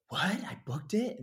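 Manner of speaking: high, rising shocked question; incredulous. Retold or imitated. Actor learns from manager he booked it, incredulous. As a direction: squeak it out in total disbelief. Reacting in disbelief to booking.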